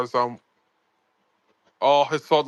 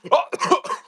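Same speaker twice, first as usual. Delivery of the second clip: choking cough; 8 semitones higher than usual. Real, not acted. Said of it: "Oh, God, I'm choking".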